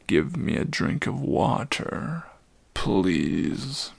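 put-on low, worn-out old voice; a spent old centaur pleading; weary old centaur. Old Chiron warning Atreyu, low weary voice.

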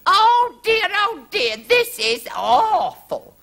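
very high, wailing mock sob; high, drawn-out theatrical despair; sobbing queen. A narrator's reading. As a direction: wail it as a distressed queen. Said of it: "sobbed the Queen".

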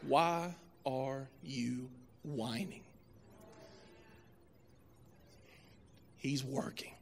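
slow, soft, low-pitched question and answer; hushed, solemn. Real, not acted. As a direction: let each word land quietly and slowly. Turns the story on the congregation.

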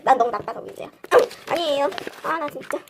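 normal talking voice

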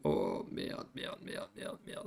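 breathy, voiceless curses under the breath; a hushed string of merde; whispered swearing. Real, not acted. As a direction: swear quietly to yourself in dismay. Dismayed muttering under breath.

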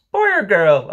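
put-on high, loud little-boy question; doubtful child. Michael asks mother which she wants, not too hopeful. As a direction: ask it as an anxious small child. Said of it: "not too hopefully".

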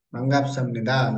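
반갑습니다 run together quickly as one phrase; quick, joined-up 반갑습니다 for a learner; fluent model reading. Shown as a demonstration. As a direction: model 반갑습니다 fast and smooth. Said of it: "Like this".

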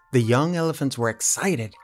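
plain reading voice

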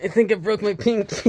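pained high laugh through words; laughing while hurt. Real, not acted. Fell hard, laughing in pain.

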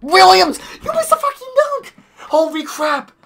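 very high, loud shocked yell; outraged disbelief. Real, not acted. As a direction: scream it in disbelief. Teammate missed a dunk.

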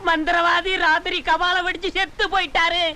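high mock lament; fake wailing. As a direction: wail out a made-up death announcement. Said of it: "அப்படின்னு ஊருக்குள்ள கதை கட்டிக்க".

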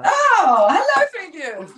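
loud, high, delighted hello; excited greeting. Real, not acted. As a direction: greet them with a burst of glee. Host greets three viewers.

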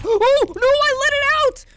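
high, loud cry of alarm; panicked cry. Real, not acted. Player who let it out, high loud panicked cry. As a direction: yelp it high in sudden panic. Frightened at releasing something.

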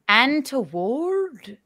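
slow, drawn-out Irish "Untoward"; mock Irish accent. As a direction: stretch "Untoward" in put-on Irish. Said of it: "how you talk in an Irish accent".

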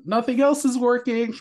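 pitched high, playful giving-up sigh of a voice; mock resignation. Host imagining the twins giving up and choosing art, pitched high. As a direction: act out caving in and choosing art. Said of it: "you suddenly were like".

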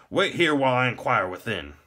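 high, loud, stiff instruction; officious gatekeeper. A narrator's reading. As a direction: order the visitor to wait, formally. Stiff gate warden orders visitor.

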